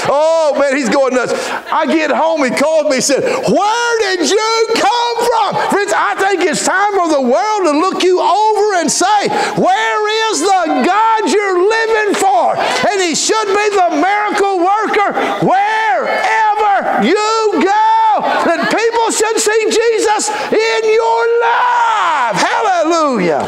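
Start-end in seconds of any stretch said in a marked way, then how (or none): 3.2-5.6 high, slow, sustained preacher's shout; preaching shout. Acted. Preacher calling out the man's question. 5.7-23.5 high, slow chanted preacher's shout; full-force preaching. Acted. Preacher exhorting friends, chanting shout.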